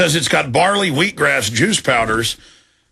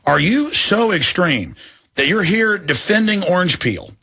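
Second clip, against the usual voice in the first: ordinary talk, nothing marked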